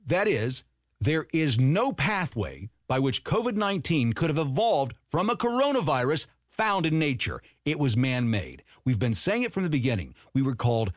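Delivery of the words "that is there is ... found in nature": each word set down for memorizing; "no pathway" stressed firmly; slow emphatic reread. Shown as a demonstration. Repeating the key line so viewers memorize it, emphatic. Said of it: "I'm gonna say that again so you can repeat it".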